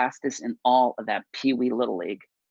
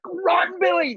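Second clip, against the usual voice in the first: much higher, shouted "Run, Billy, run!"; father yelling; 4 dB louder, slower than usual. Retold or imitated. Father yelling at his son from the stands, a loud high shout. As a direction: holler him down the base path. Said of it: "everyone's like".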